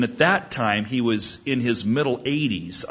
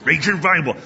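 put-on higher, jaw-out old professor repeating his answer; imitating Doctor Ebling; 4 semitones higher than usual. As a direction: brush off a question like a crusty old teacher. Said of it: "he would say".

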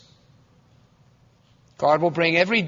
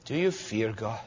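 hushed question; 8 semitones lower, 9 dB quieter than usual. Acted. Direct question to listeners.

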